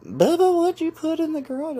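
very high mock sister voice; loud, high-pitched mimicry of a sister; imitating his sister. Sister questions her brother about the garage, mock high voice.

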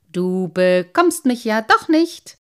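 slow, loud teasing; mocking singsong jeer; taunting. A narrator's reading. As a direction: draw it out cheekily to goad him. Sprite taunts his pursuer.

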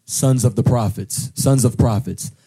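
ordinary delivery

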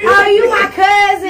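big, loud, high Mississippi welcome; loud Southern cousin greeting. Retold or imitated. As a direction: shout it like an excited country cousin. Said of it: "And then you come in".

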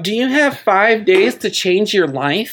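high, bubbly sales pitch voice; gushing upbeat sales pitch; MLM saleslady voice. Retold or imitated. Mocking MLM sales posts.

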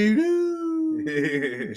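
high, slow cartoon dog voice; Scooby-Doo voice. Sings cartoon catchphrase.

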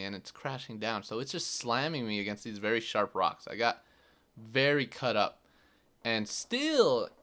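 ordinary delivery

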